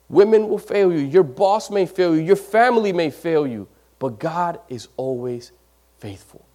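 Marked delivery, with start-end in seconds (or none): none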